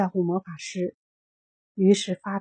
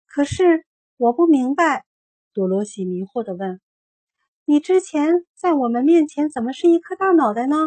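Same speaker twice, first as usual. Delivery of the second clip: put-on confused girl's question, higher; higher, puzzled child's voice; 6 semitones higher, 5 dB louder than usual. A narrator's reading. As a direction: ask in bewilderment.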